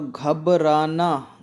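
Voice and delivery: "घबराना" broken slowly into "ghab-raa-naa"; careful pronunciation. Shown as a demonstration. Teacher models spelling for students, "घबराना" slow in syllables. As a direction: draw out "घबराना" with a clear "r". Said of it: "घबराना होता है".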